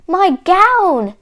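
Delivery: high, loud cry of discovery; joyful storybook exclamation; delighted yell. A narrator's reading. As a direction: yell it like a long-lost treasure found. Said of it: "he yelled".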